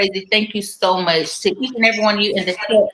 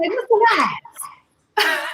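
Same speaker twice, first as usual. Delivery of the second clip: high sing-song name; 9 semitones higher than usual. Retold or imitated. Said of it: "And I be like".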